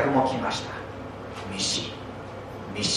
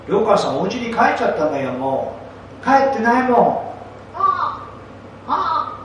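put-on high, excited children; child voices; 7 semitones higher than usual. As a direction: squeal like little kids. Children looking for Ryokan.

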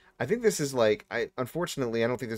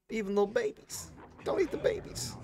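mock pleading; 12 semitones higher, 6 dB quieter than usual. Mock pleading about eating babies.

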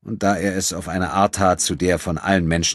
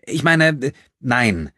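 high, louder, stumbling correction; flustered; 8 semitones higher, 6 dB louder, slower than usual. A narrator's reading. Caught-out thief correcting herself, flustered. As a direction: backpedal in a raised pitch.

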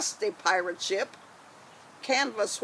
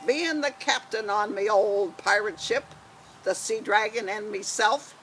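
pirate voice. Acted. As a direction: boom it out like an old sea dog. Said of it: "gonna be Janie with her pirate voice".

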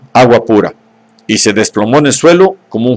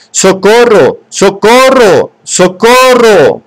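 each cry higher and slower, up to a drawn-out wail; building shouts; 9 semitones higher, 3 dB louder, much slower than usual. A narrator's reading. Bernard shouting for help ever higher to the police. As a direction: keep calling, climbing higher and slower each time. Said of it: "cada vez más fuerte".

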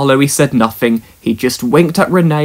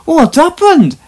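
much higher, loud, anxious little engine; 5 dB louder than usual. Acted. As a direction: blurt it out, worried and stumbling. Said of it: "asked Duncan anxiously".

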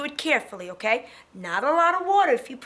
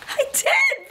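thrilled cry. Real, not acted. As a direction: cry out in triumph at the painted circle. Succeeds at painting circle.

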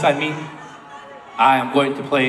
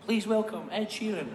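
quiet mock announcer; 3 semitones higher, 9 dB quieter than usual. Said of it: "where I say".

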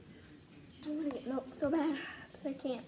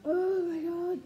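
loud pained outburst; loud burning exclaim; 5 semitones higher, 6 dB louder, slower than usual. Real, not acted. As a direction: yell it as the spice hits. Mouth burning from spice.

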